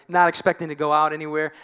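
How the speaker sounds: ordinary talk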